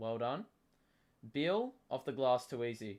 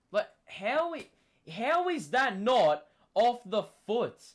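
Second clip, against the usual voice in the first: incredulous; 7 semitones higher, 6 dB louder than usual. Real, not acted. Disbelief at the missed call.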